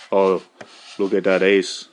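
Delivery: low put-on Alaskan accent; attempted Alaskan accent. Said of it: "Is that an Alaskan accent?".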